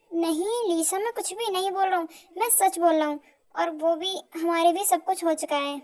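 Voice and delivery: usual speaking voice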